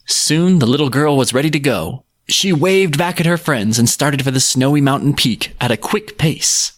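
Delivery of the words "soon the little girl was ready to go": usual speaking voice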